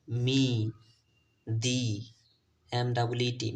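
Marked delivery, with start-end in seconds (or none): 0.1-1.8 "মি", "দি" singly with a clear ee. Shown as a demonstration. Teacher models words for learners, "মি" and "দি" distinctly with ee. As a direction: model "মি" then "দি", paused, vowel as ee. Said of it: "ই এর উচ্চারণ হয়েছে ই এর মতো".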